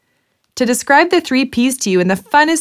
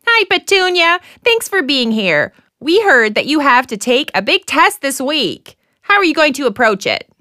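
game show host; 5 semitones higher than usual. Acted.

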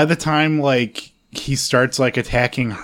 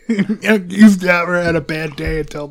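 slow, raised, hammed-up villain impression; mock threat, slow and half laughing; menacing movie villain; 5 semitones higher, slower than usual. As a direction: imitate the crazed guy, drawing out the threat. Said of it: "this guy's like".

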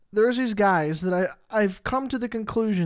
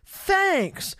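pitched way up, squeaky thanks; high squeaky thanks; 12 semitones higher than usual. Retold or imitated. Retold reply to compliment.

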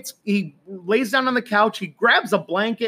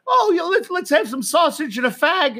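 high, excited mock Scottish voice; Scottish lilt, pitched up; Scottish host; 9 semitones higher than usual. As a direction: play the cheery Scottish host offering breakfast. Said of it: "they said".

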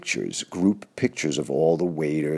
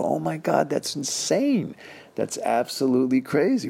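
amazed; 10 semitones higher than usual. Retold or imitated. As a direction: retell the moment the camp owner blew his mind. Amazed at photo reveal.

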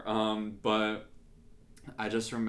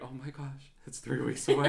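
higher, laughing dismay; hushed gasp building to louder, laughing panic; mock panic; 5 semitones higher, 4 dB louder than usual. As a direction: play up the panic at the deadline. Acted panic at nearing date.

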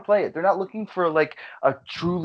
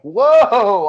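startled whoa; 5 semitones higher, 10 dB louder than usual. Retold or imitated. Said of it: "and you're like".

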